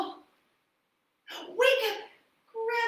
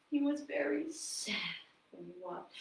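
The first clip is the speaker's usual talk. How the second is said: fading softer to almost nothing; sad telling; 4 semitones lower, 8 dB quieter than usual. A narrator's reading. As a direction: let sadness drain the voice. Sad walk narration fades.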